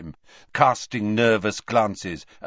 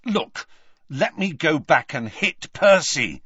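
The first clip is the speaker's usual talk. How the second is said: said loudly; 9 semitones higher, 5 dB louder than usual. A narrator's reading. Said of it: "Then he said loudly to Hermione".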